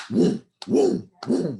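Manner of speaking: booming mouth-made "woom" pulses; punchy, repeated sub-bass imitation; imitated bass thump. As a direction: mimic a club's low end thudding through walls. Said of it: "You're hearing this low end sound".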